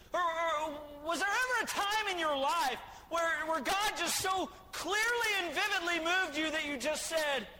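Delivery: loud, high shouted preaching; preaching at full force. Real, not acted. Preacher rousing the church to excitement, shouting. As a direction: whip the congregation up, shouting.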